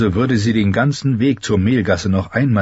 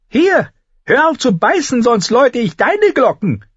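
much higher woman's voice calling down; 4 dB louder than usual. Acted. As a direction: shout down from the window. (hohe Frauenstimme, ruft herab)

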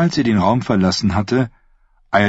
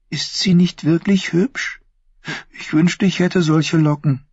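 put-on young woman's voice set higher than the narration, sweetly scheming; Thyene's voice; 9 semitones higher than usual. Thyene to her uncle the prince, sweetly scheming. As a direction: speak as the sly, innocent-sounding niece.

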